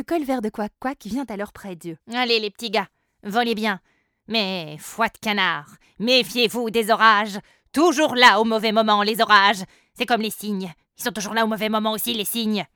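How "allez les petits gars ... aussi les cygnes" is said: loud blustering duck voice; gruff duck. Acted. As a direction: bellow like a grumpy old duck. Monsieur Colvert the duck speaks.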